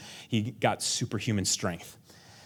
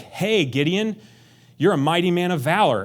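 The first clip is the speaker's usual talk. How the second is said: hearty God voice; 6 semitones higher, 5 dB louder than usual. Retold or imitated. God addressing Gideon.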